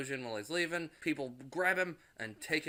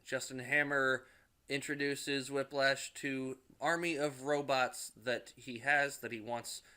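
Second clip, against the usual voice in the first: ordinary delivery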